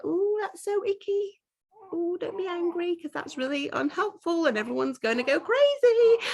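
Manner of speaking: mock the people who find anger icky; prissy mock voice. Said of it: "everyone sees anger as really like".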